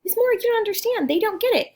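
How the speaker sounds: high-pitched, loud mock complaint; protesting pupil. Pupil telling teacher younger kids won't understand, high protest. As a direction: imitate an exasperated pupil up high. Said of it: "you're like".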